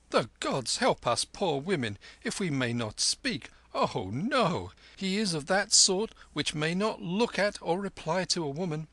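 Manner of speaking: high, loud indignant grumble; a peasant wife huffing at being ignored. Acted. Wife complaining the lama will not look at her, high and loud.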